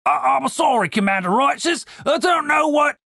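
high, stammering plea; panicked underling, voice pitched well up; flustered apology. A narrator's reading. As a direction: blurt apologies to an angry commander. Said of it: "competed to out-apologize one another".

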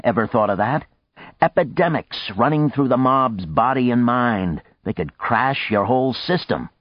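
a touch of impatient lecturing; exasperated arguer. A narrator's reading. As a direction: press the warning with mild exasperation.

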